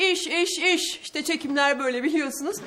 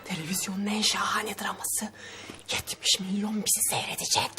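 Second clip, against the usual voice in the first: whispering; 6 semitones lower, 6 dB quieter than usual. Real, not acted. Scolding aside during shoot.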